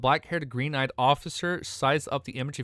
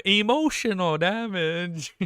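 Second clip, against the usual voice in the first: meme voice; 5 semitones higher, much slower than usual. Retold or imitated. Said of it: "What's that one meme?".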